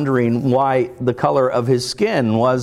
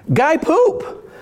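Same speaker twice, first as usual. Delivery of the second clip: put-on high excited child announcing; little kid voice. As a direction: squeal it like a toddler.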